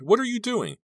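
very high-pitched protest; high, startled demand; alarmed cry. Acted. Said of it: "she cried".

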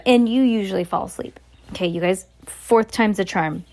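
usual speaking voice

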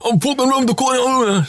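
[name] impression. Friend mimicking the host on the phone, mock [name] accent. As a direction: put on an exaggerated [name] accent. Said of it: "that's just [name]'s impression of me as a [name]".

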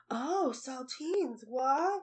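slow, soft, breathy sultry reaction. Retold or imitated. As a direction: purr it out like a reality show contestant. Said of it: "why are they all using their sultriest voices".

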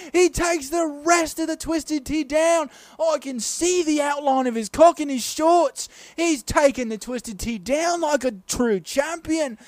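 very high, loud British play-by-play frenzy; British sports commentator. Acted. Crowd goes wild at the chug, ecstatic British commentator. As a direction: holler the climax, stretching each phrase. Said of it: "I'll commentate the whole bit".

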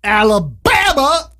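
drawn-out, high-pitched movie-quote Alabama; Forrest Gump. Retold or imitated. Host announcing the state, Forrest Gump-style drawl. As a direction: stretch it out and pitch it up. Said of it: "Forrest Gump".

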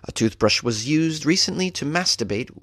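normal talking voice

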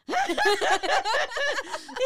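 high loud cackle; high burst of laughter. Real, not acted. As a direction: laugh at her own joke. Laughing after retold line.

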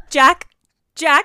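loud urgent call to a husband downstairs. Retold or imitated. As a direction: call his name twice, urgent and loud. Calling husband from stairs.

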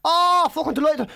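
high, thrilled fan impression; gushing fan. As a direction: gush like a starstruck stranger. Retold stranger thrilled to meet him.

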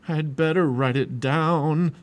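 slow, loud, shivery voice; shivering. A narrator's reading. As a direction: force the words out slowly through shivers.

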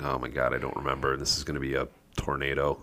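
soft, low aside; quiet, sinking mock panic; mock dread. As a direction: mutter it low as a retold worried thought. Dad dreading toddler's reaction, retold.

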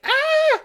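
short, very high mock shriek; startled yelp, loud and piercing, imitated; sudden hammed-up squeal of fright; mock scream. Imitates Lily as unicorn charges.

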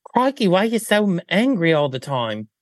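high-pitched hammed-up Australian; mock Australian accent. Australian designer questions the angry witch, mock Australian accent. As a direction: leap up on the first word, broad put-on Australian. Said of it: "And then he says".

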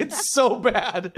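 high, loud, drawn-out verdict through laughter; mock dismay. Retelling reaction to an actor's English accent, mock dismay.

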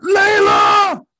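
high, loud yell across the fight; shouting a name. Retold or imitated. Mark yelling for Layla as she is attacked, loud call. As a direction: call her name in alarm.

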